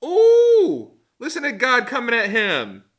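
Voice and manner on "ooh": very high, loud ooh at a burn; a long, sing-song ooh of someone being told off; shrill taunting ooh; teasing ooh. Real, not acted. Reacting to God's rebuke.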